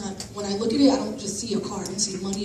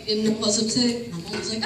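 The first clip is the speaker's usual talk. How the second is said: ordinary talk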